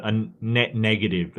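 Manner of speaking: normal talking voice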